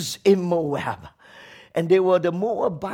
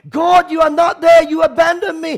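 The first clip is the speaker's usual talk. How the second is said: raised, pitched-up lament at God; abandoned cry; 8 semitones higher, 3 dB louder than usual. Retold or imitated. Preacher voicing despair to God on Good Friday, raised. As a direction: cry out to God as one forsaken. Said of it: "it's like".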